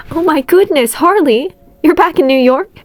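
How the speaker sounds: high, loud surprised aunt; aunt thrilled to hear Harley is back; delighted surprise. A narrator's reading. May shocked, delighted.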